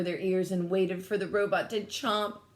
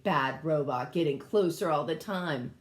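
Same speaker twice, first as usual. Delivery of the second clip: ordinary delivery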